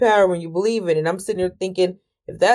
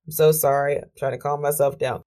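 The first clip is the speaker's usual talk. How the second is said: usual speaking voice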